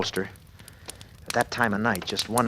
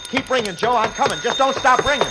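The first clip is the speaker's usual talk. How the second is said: much higher, breathless urgent plea; much higher frantic plea while running to the phone; frantic pleading; 13 semitones higher, 3 dB louder than usual. Acted. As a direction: beg the phone not to stop, rushing.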